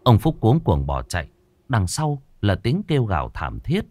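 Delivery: ordinary delivery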